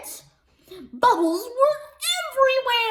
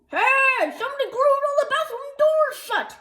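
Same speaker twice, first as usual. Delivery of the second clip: fan shout; 8 semitones higher than usual. A narrator's reading. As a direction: yell it across the bleachers. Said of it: "people shouting".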